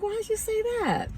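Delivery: put-on very high, puzzled old-lady voice; sweet old lady. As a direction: ask it high, imitating a sweet old woman. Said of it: "little sweet old lady".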